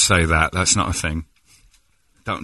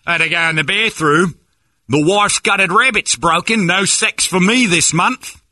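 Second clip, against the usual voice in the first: high, slow, loud Aussie caricature; hammed-up fake Australian; mock Australian accent; 11 semitones higher, 5 dB louder, slower than usual. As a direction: draw it out as a silly Australian. Continues fake Aussie slang riff.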